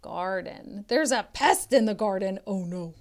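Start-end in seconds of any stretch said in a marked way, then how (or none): none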